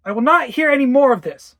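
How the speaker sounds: loud, high angry outburst; shouted. Acted. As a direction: lose your temper at the child. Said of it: "I shouted".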